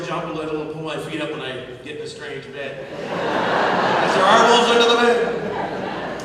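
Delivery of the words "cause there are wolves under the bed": laughing. Real, not acted.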